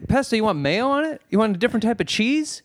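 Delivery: high, eager patter; imitated excited server. Imitating chatty sandwich guy to a customer, eager and high. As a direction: fire off friendly questions in a peppy voice. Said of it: "he was so excited to make my".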